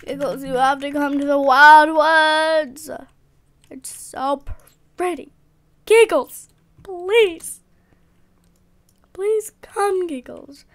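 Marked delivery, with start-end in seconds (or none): 0.0-3.1 loud call to a friend. Real, not acted. Calling out to friend Giggles. 5.8-10.1 pleading. Real, not acted. Begging friend to come.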